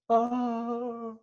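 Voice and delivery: short high imitation scream; mock scream. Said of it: "is screaming the loudest".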